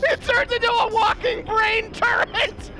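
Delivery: thrilled shouting with laughter; excited shouting. Real, not acted. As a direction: yell it out in delight. Excited discovery of weapon.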